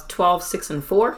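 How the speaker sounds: ordinary talk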